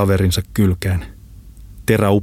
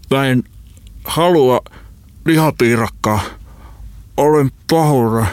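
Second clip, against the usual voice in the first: high, strangled voice in broken bursts; choking; 6 semitones higher than usual. A narrator's reading. Friend apologises while being throttled, choking out the words. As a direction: force words out against a hand on the throat. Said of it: "Holopainen kakisteli".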